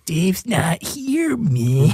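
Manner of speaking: high, slow stoner voice; goofy spaced-out drawl; Tommy Chong. Acted. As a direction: drag out here, then drop on man. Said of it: "is just Tommy Chong from fucking my childhood".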